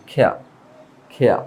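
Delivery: খেয়া with aspirated kh, no final R; British pronunciation. Shown as a demonstration. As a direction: draw out খেয়া with a puffed K, R dropped. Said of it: "এই যে একটা টান হবে". (খেয়া, খ দিয়ে, শেষে র ছাড়া টান)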